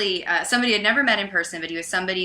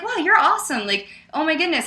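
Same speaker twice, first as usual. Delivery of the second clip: high-pitched, impressed gushing; imitated amazement; 8 semitones higher than usual. Man surprised she stayed friendly, imitated amazement. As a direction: mimic someone delighted and surprised. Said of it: "He was really surprised".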